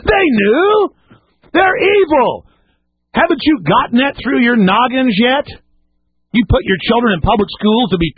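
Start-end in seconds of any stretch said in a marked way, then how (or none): 0.1-5.5 much higher, stammering into a cry; outraged cry. Real, not acted. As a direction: choke up with outrage. Outraged about schools.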